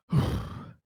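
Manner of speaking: a soft breathy sigh of relief; relieved sigh. Acted. Conrad sighs in relief that the ship is secure, soft sigh. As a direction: let the air out like a weight has lifted.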